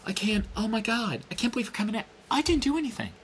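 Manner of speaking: mock innocent whine. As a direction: play the hurt innocent, all high and small. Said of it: "she has the balls to sit there and go".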